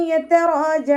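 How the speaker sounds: high, slow chanted verse; recitation demo. Shown as a demonstration.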